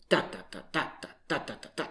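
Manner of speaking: "da" as hard choppy beats, some stressed; German as outsiders hear it. Shown as a demonstration. Teacher showing what non-speakers hear, hard staccato stresses. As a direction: rap out "DA da da, DA da" in staccato bursts. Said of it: "if they only hear it".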